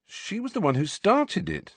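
high-pitched self-justification; defensive. A narrator's reading. Harry defending himself over the kiss.

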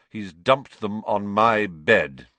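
slow, loud, aggrieved complaint; long-suffering. A narrator's reading. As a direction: stretch each word like a martyr. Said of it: "in a long-suffering voice".

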